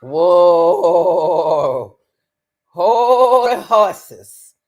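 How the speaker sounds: loud, slow hold-up; loud drawn-out whoa. Real, not acted. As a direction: stretch it out to stop them. Stopping the testimony.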